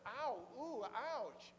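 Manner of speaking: mock wince of pain; pained ouch. Reader stung by convicting words of James, mock pained. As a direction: groan lightly as if stung. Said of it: "it's ouch or amen".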